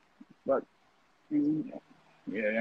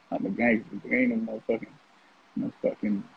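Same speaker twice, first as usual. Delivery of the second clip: usual speaking voice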